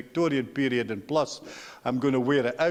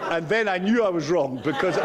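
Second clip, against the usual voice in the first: laughing; 2 semitones higher than usual. Real, not acted. Laughing at his own mistake.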